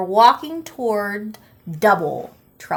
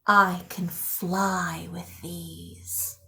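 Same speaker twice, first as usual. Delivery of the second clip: soft, slow, low secretive whisper; 5 semitones lower, 9 dB quieter, much slower than usual. Acted. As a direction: let them in on a big secret. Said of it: "I whispered as if it were a big secret".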